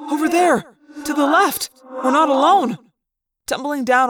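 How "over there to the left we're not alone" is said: high-pitched calling out; excited raised cries of warning; urgent cry. A narrator's reading. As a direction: point out the danger in alarm. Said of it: "Tobias cried".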